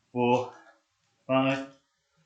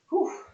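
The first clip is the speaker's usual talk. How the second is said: relieved exhale. Real, not acted. Exhale after a hard push-up set.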